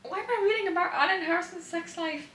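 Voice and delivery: embarrassed disbelief. Real, not acted. Reading parents' sex scene.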